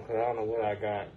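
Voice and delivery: muffled through face mask; talking behind a face mask. Real, not acted. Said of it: "I had to put my face mask on".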